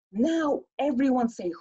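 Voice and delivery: ordinary delivery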